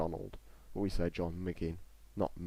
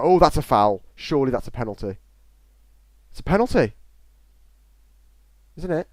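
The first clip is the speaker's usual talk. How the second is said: outraged shout; 5 semitones higher, 12 dB louder than usual. Real, not acted. Appealing for a penalty.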